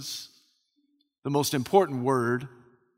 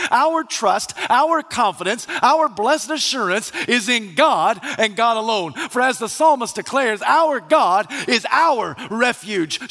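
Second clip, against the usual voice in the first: raised, driving pulpit proclamation; forceful preaching; 5 semitones higher, 4 dB louder than usual. Real, not acted. Preacher tells congregation where trust lies, impassioned and emphatic. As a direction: build phrase on phrase with high-pitched conviction.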